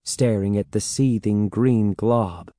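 usual speaking voice

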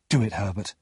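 ordinary delivery

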